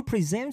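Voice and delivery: "present" said slowly as pre-ZENT, stressing the second syllable. Shown as a demonstration. Teacher asking students to follow along, second-syllable stress on "present". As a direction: model "present" as a verb, leaning on -SENT. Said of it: "重音呢在第二个音节".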